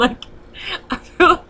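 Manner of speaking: words breaking up into high laughter; giggling too much to finish the sentence; real laughter. Real, not acted. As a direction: laugh through a guilty confession. Laughs at own confession about punching.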